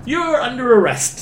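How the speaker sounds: high, slow, loud cop pronouncement; drawn-out pretend police declaration; mock arresting officer. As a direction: announce it like a cartoon cop. Acts the cop making the arrest.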